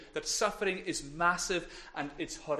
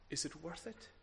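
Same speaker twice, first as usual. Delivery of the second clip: soft searching question; 16 dB quieter, faster than usual. Real, not acted. Preacher asks searching question.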